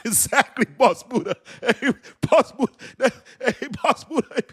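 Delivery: high, squealing through laughter; cracking up while repeating a listener's joke; real laughter. Real, not acted. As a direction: talk through helpless high-pitched laughs.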